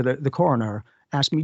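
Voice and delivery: normal talking voice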